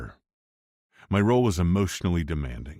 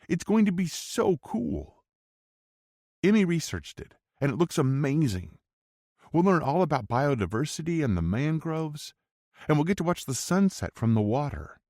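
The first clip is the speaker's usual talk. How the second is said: put-on high, eager child voice; excited little girl; 10 semitones higher than usual. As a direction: bubbly kid gushing about a trip. Excited daughter Brooklyn speaking.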